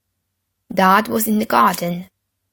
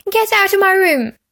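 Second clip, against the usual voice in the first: put-on high, loud bossy boy; 11 semitones higher, 5 dB louder than usual. A narrator's reading. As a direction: order him out, high and loud. Peter orders Henry out.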